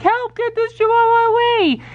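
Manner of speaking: high, hammed-up cry for help; Toby, mock terrified. Owner voices Toby scared of a stuffed Chihuahua, mock panic. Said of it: "He's, like, terrified".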